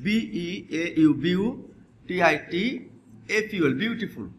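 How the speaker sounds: বিই এ ইউ spelled slowly letter by letter; slow spelling aloud. Shown as a demonstration. Teacher spells বিউটিফুল slowly for students. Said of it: "অথবা আমরা যদি লিখি".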